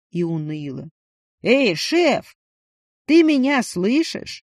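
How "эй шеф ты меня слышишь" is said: loud, higher call over noise; shouting into the phone. Acted. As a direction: yell to be heard.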